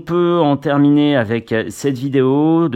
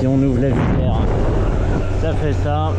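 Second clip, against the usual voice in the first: inside a motorcycle helmet. Real, not acted. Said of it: "le test de l'Instago trois S dans le casque".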